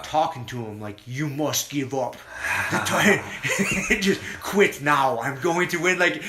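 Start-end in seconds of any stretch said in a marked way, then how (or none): 1.1-6.0 imitating a thick Russian accent in broken English, with laughter breaking in; mock Russian fighter taunting his opponent in a Russian accent; Khabib's Russian accent. Taunting the pinned opponent against the cage, mock Russian accent. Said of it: "his broken English and the thick Russian accent".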